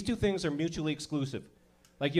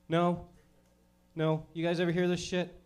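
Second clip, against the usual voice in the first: ordinary talk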